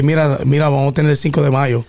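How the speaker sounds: fast Puerto Rican Spanish; quick Puerto Rican accent. Shown as a demonstration. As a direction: rattle it off Puerto Rican style. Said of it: "No, you would say".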